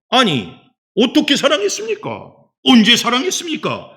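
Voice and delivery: high, loud mock demand for proof; indignant protest. Israelites demanding that God explain His love, indignant protest. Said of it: "이렇게 항변을 해요".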